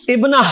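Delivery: ابنہ ending in a breath of h, no t; final h sound. Shown as a demonstration. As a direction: finish ابنہ on h instead of t. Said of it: "میں ہا کی آواز نکال رہا ہوں".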